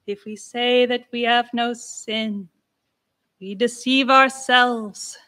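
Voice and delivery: slow, intoned on a held pitch; slow churchy intoning; church monotone. Shown as a demonstration. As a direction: chant it like plainsong. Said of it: "of a church monotone".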